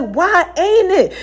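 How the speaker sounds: high, slow whining question; whiny protest. Retold or imitated. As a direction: drag it out like a sulky kid. Whining back at God in story.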